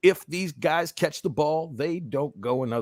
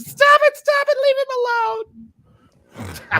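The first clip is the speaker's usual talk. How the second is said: very high, loud frantic shriek; hysterical mock scream; 8 dB louder, much slower than usual. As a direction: scream it like a panicked wife on the field. Same acted scream, now for receiver.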